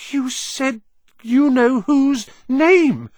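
high, loud, awestruck outburst; shocked and impressed. A narrator's reading. Ron hearing the forbidden name spoken, shocked and impressed. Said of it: "sounding both shocked and impressed".

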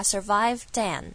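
"survived and" run into one phrase; "survived and" joined without a break; linked pronunciation. Shown as a demonstration. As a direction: carry the d of "survived" straight into "and". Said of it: "如果做连音的话".

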